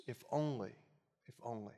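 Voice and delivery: soft, fading wistful refrain; quiet regret. Acted. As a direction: let it trail away in regret. Voicing regret over past choices.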